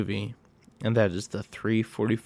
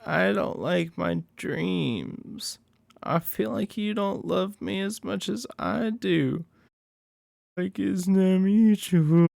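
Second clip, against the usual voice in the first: put-on much higher, slow and pleading, a needy girlfriend; clingy girlfriend voice; 9 semitones higher, slower than usual. Retelling the movie girlfriend's eerie confession, high and slow. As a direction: draw it out high like an unsettling lover who wants more love.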